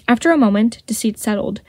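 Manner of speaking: ordinary delivery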